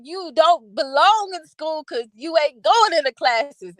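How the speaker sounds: mother laying down the law, drawn out; scolding son. Retold or imitated. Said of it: "I said".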